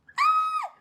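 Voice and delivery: short, loud high-pitched squeal; high squeal of cringe. Real, not acted. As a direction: squeal out in embarrassed dread. Dreads the cringe scene coming up.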